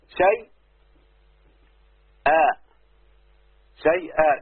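شيء, a gap, then a long آ; split into parts. Shown as a demonstration. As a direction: sound out شيء and آ as separate pieces. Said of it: "خد بالك اهو". (نطق شيء ثم آ منفصلتين بمدّ)

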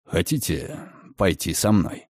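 low, soft, calm male voice; a quiet, unhurried invitation; sly gentleman. Acted. Redfern invites Margaret to come along, low and calm.

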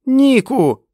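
very high, loud cry; desperate scream. A narrator's reading. Fran calls Nick as he pushes her, screaming. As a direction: scream his name.

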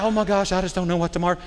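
quick, fretful mock worrier voice. Preacher mocks a worried churchgoer, fast and fretful. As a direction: play a fussy worrier rushing the words. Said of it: "You're all worried and like".

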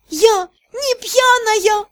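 put-on slow, high, slurred drunk protest; tipsy. A narrator's reading. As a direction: drag it out tipsy and giddy. Drunk heroine answers friends.

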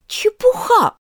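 put-on high, emphatic, resolute girl's retort; loud and decisive. A narrator's reading. Girl defying the raging queen, loud and decisive. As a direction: cut her off firmly, pitched high. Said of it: "сказала Алиса очень громко и решительно".

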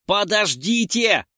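high, loud, stretched-out cry; desperate shout. A narrator's reading. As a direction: shout to halt them, dragging it out. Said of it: "закричал Дронго". (протяжный отчаянный крик)

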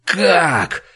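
high, enraged outburst; furious. A narrator's reading. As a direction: fire the question out in fury. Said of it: "разъярённо спрашивает он".

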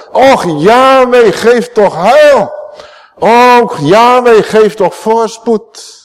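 high, loud and slow, each word drawn out; fervent psalm prayer. Acted. The preacher cries the psalm's prayer to God, loud and slow. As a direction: pray the psalm at full fervour.

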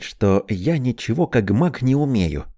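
ordinary delivery

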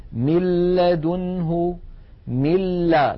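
من لدنه recited slowly as "mil-ladunhu", straight onto a doubled l; doubled lam, no ghunna. Shown as a demonstration. Teacher showing merging without ghunna, doubled l in من لدنه. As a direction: drop the n of من and lean on the l of لدنه, no nasal hum. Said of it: "من الميم إلى اللام مباشرة".

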